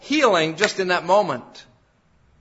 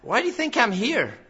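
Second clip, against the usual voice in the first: mock protest; 6 semitones higher than usual. Lame man imagined objecting to Jesus, incredulous protest. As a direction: push back in disbelief. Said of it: "He didn't say".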